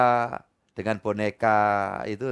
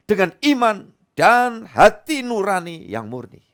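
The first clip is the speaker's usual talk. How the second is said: raised, emphatic Bible reading, slowing at the end; scripture reading; 9 semitones higher, 7 dB louder than usual. Acted. Pastor proclaims Timothy verse to congregation, emphatic reading.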